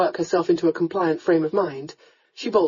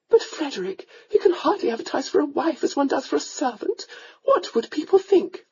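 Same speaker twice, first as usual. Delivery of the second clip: higher, fluttery mother's voice; a mother coaxing her son to marry; countess; 8 semitones higher than usual. A narrator's reading. Countess to her son, higher fluttery voice.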